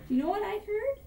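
high-pitched, wide-eyed storytelling rabbit; boastful rabbit. A narrator's reading. Rabbit tells the bear what he heard, high and boastful. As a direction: pitch it up, as if sharing a marvel. Rabbit reports an amazing feat.

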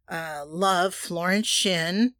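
ordinary delivery

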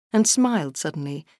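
plain reading voice

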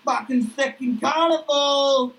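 high brash bloke bursting through the door; Duck's voice. Acted. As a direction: pitch it way up, cocky and crude. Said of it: "Duck kicks open the door and goes".

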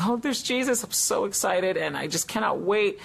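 high, bright-eyed rush of joy; eager gasp of delight; excited. Retold or imitated. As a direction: relive the thrill of seeing him. Retelling his excitement at seeing Jesus.

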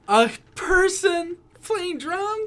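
high, slow gasp of amazement; awed onlooker. Retold or imitated. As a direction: marvel at it, drawn out and high. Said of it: "You'd be like".

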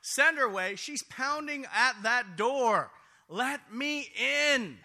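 loud, high, slow pressing plea; urgent demand. Acted. Disciples urging Jesus, loud and insistent. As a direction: push him hard to send her off. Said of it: "urged him".

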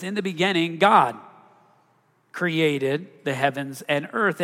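normal talking voice

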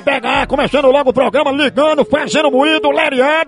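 loud, high-energy radio host; full-volume hype announcing; hyped show announcer. Acted. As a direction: belt out the prank show intro. Shouted opening of prank show.

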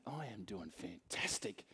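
soft, slow, low secret; hushed confession. Retold or imitated. Preacher confiding to a friend, low and hushed. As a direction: lean in and confide it quietly.

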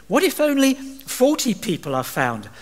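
high hopeful question; lightly voiced haggling Abraham; bargaining Abraham. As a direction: ask as if it costs nothing.

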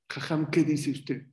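ordinary talk

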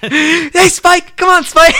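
high-pitched, yappy pestering; eager little-dog sidekick. Retold or imitated. Little dog pestering the big dog, eager impression. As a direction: imitate an excitable little tagalong pal.

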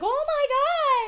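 high, loud, drawn-out squeal of excitement; excited fan. Retold or imitated. Fan hears the co-host is there, excited. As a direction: gush it like a starstruck fan. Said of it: "she got all excited".

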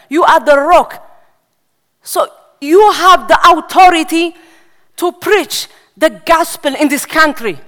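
loud, rising sermon exhortation, hammering each phrase; full-force preaching. Acted. Preacher rousing young believers to evangelize Europe, loud preaching force. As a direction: preach it out like a revival call.

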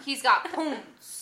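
"poons" for pants, vowel as ooh; ooh language. Shown as a demonstration. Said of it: "which is pants".

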